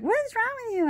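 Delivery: very high-pitched mocking imitation of friend; mimicked indignation. Friend questioning her after the outburst, high mimicked indignation.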